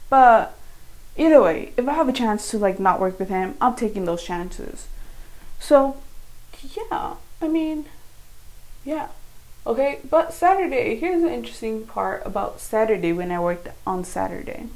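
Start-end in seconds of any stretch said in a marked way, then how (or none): none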